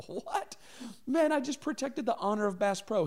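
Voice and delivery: high, laughing disbelief; can't believe you got fired; reacting to being fired, laughing in disbelief; incredulous. Incredulous retold self, laughing.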